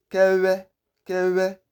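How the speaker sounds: Kewe high and level, w squeezed through lips; correct vw pronunciation. Shown as a demonstration. Teacher restating the name, correct vw pronunciation. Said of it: "It is Kewe".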